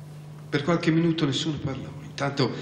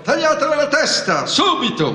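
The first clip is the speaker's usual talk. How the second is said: very high, louder shrieked order; ferocious shout; 7 dB louder than usual. A narrator's reading. Queen orders Alice's head cut off, ferocious shout. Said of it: "selvaggiamente come una bestia feroce, gridò".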